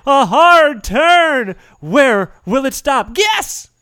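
loud, slow, building suspense; dramatic suspense. A narrator's reading. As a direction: drag out the spin for tension. Reads the wheel spin dramatically.

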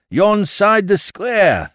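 a slow mock Yorkshire phrase inside the Cockney; drawn-out Yorkshire imitation, slow. Landlord mimics the northerners, slow mock Yorkshire. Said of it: "Blowed if I didn't catch myself saying".

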